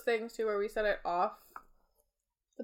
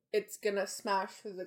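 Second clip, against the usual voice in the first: usual speaking voice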